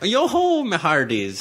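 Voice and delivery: high, rollicking pirate call. Retold or imitated. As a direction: mimic a hammed-up pirate. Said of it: "like you're a pirate who's like".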